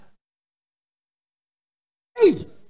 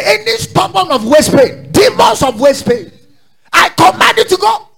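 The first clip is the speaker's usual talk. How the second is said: commanding shout, building higher; shouting faith healer; 6 semitones higher, 7 dB louder than usual. Retold or imitated. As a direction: rebuke the demons, louder each line. Retold commanding demons away.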